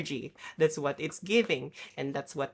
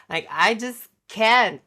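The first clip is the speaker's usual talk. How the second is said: "Like, I just can't" much louder, higher; "can't" thrown out loud in exasperation; exasperated outburst; 5 semitones higher, 10 dB louder than usual. Real, not acted. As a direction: blurt it out fed up.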